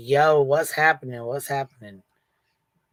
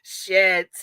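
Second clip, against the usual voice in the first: laughing; 3 semitones higher, 3 dB louder, much slower than usual. Pretend. Repeats it, laughing.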